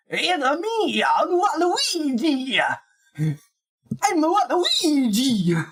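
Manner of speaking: high, slow Waluigi voice; a Mario voice pushed up into Luigi's range. Shown as a demonstration. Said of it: "trying to do a Mario while in the Luigi range".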